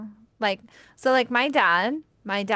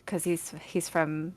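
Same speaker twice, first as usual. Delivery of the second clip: ordinary talk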